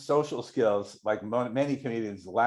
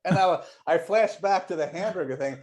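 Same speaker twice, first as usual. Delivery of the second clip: ordinary delivery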